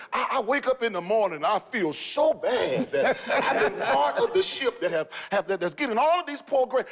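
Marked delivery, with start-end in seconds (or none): 1.4-6.9 lightly voiced, whiny board member; a hint of a guilty official; mock board member. Pastor mimicking remorseful school board members. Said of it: "I heard some of the school board members".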